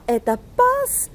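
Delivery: slow, "passe" leaps high and is cut off there; abrupt rise. Shown as a demonstration. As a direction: jump up sharply on "passe" and hold it high. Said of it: "l'intonation monte brusquement". (montée brusque et tenue sur « passe »)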